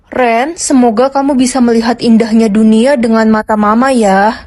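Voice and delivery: a hint of a loving mother speaking from beyond; mother's voice reading her letter to her son; dead mother's letter.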